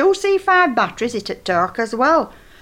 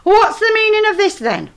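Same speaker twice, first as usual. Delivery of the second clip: son's demand, pitched much higher and louder; angry son; 12 semitones higher, 5 dB louder than usual. Retold or imitated. Old woman mimicking her son confronting her at the gate. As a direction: mimic Ian bursting in, outraged. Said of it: "He dumped it on floor and said".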